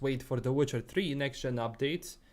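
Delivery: ordinary talk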